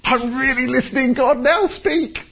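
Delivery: high, slow, drawn-out plea; high breathy-free eager listening voice; acting out listening. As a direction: stretch it out high as if straining to hear. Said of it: "And it's kind of like".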